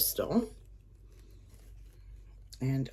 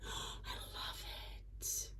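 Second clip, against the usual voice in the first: gasping whisper; 20 dB quieter than usual. Real, not acted. Gasps at the item.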